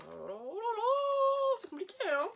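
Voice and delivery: mock exasperated wail at the stubborn packaging; exaggerated oh là là. Real, not acted. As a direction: ham up the frustration, stretching it high. Struggling to open the package.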